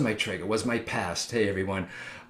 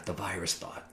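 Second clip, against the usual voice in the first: much softer, dropping down low; quietly naming "the virus thought"; hushed; 5 semitones lower, 11 dB quieter than usual. Real, not acted. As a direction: let it fall to a hush. Describing the thought always there.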